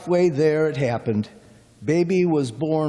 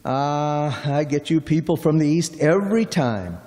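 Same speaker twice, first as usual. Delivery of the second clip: ordinary delivery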